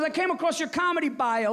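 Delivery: put-on high, hearty old Italian dad; dad on the phone. Bronx father calling his son, hearty high greeting. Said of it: "He goes".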